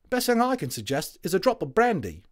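cheery London Tommy; cockney soldier. A narrator's reading.

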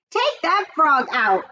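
slow, high, firm command; ordering. A narrator's reading. Fern ordering her brother, slow and commanding. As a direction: boss him around. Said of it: "ordered Fern".

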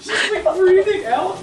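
talking through loud laughter; laughing. Real, not acted. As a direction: laugh while describing. Laughing at friend.

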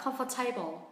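"comfortable" said like "com-FOR-table"; "comfortable" read as comfort plus table; mispronounced as comfort-table. Shown as a demonstration. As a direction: wrongly end "comfortable" with the word "table". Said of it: "Trọng âm rơi âm thứ hai".

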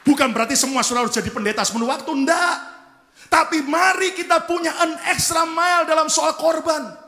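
high, loud shouted exhortation; impassioned pulpit shout; full-force preaching. Real, not acted. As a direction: urge the whole congregation at full volume.

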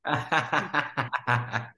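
a laugh that breaks into a squeak; laugh with voice crack. Real, not acted. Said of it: "me reí que se me salió un gallo".

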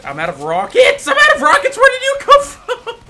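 high, loud yell through laughter; laughing panicked shout. Real, not acted.

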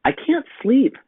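put-on high child's plea; small boy asking mama; little boy voice. A narrator's reading. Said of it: "said Jake".

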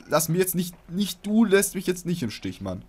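high, loud plea not to be abandoned; mock pleading. Player begs the balloon not to let him down, mock desperate pleading. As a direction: beg dramatically, half joking.

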